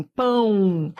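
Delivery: "pão" drawn out, gliding into a U sound; som do ditongo. Shown as a demonstration. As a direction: stretch "pão" so the final U is heard. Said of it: "quando eu digo assim". ("pão" alongado, terminando em som de U)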